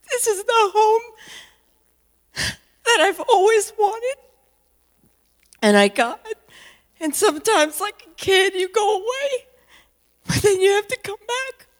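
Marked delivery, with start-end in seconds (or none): 0.1-6.2 tearful. Real, not acted. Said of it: "And I'm gonna cry". 10.3-11.7 tearful. Real, not acted. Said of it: "And I'm gonna cry".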